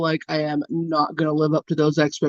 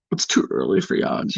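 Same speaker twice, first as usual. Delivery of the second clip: mock low voice; 4 dB quieter than usual. Teasing chat about yawning, in a mock low voice. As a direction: drop the voice low as a joke.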